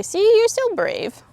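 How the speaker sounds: high, slow, encouraging coo; coaxing praise. Real, not acted. Trainer coaxing her scared horse, high and slow. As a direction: lift and stretch it out to soothe him.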